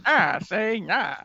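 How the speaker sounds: slow, drawn-out mock gangster; hammed-up tough guy drawl; weird gangster voice. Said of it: "sounds like a weird gangster voice".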